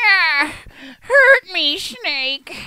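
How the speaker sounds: high, loud theatrical villain cry; hammed-up Gray Fox. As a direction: shriek it like an over-the-top villain. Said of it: "he was like".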